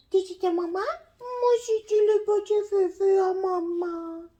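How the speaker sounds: put-on high, squeaky baby talk to the pet; high sing-song voice for a pet. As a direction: coo at the little one in a high voice. Talking to the pet as mum.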